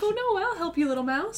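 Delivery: high, cooing offer of help; high-voiced little reassurance; kindly rescuer. Boy finding a trapped mouse, high and cooing. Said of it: "He's like".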